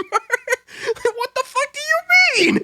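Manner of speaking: very high, drawn-out squawk with laughter; outraged disbelief. Retold or imitated. Guard repeating outburst before a customer, slow high outraged cry. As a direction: stretch it out in shrill comic outrage. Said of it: "I literally said that out loud".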